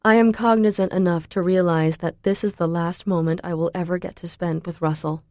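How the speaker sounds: ordinary delivery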